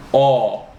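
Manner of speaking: aw as an open sound between O and ah; vowel between O and ah. Shown as a demonstration. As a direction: say aw, halfway between O and ah. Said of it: "It's not O, and it's not ah".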